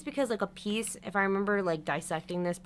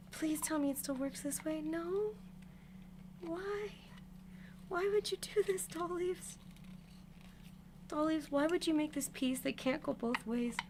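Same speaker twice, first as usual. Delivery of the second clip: pleading whine; 7 semitones higher, 8 dB quieter than usual. Real, not acted. Pleading over wrong piece.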